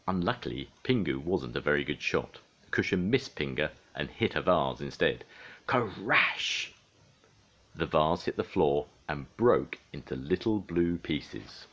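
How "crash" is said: high, drawn-out crash noise; imitated crash. A narrator's reading. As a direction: sound out the smash with relish. Imitates vase breaking.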